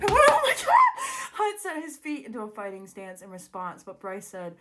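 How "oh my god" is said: very high, drawn-out laughing shriek; real squeal of uncontainable excitement; overexcited scream. Real, not acted. Reader losing it over the scene, screaming with excitement. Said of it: "This is too exciting for my brain".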